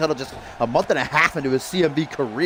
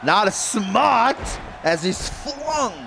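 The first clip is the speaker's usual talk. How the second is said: put-on slow, drawn-out Italian accent, pitched higher; mock Italian accent; 7 semitones higher, much slower than usual. Commentator slipping into a jokey Italian accent mid-call, slow and higher. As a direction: stretch it out like a hammed-up Italian announcer. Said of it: "I turned Italian there for a second".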